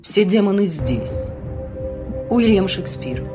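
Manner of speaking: plain reading voice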